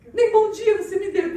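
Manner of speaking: high, loud whiny voice with a laugh; whiny wife complaint. Retold or imitated. Sulking wife complains to her husband, high and whiny. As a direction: pout it like an offended wife.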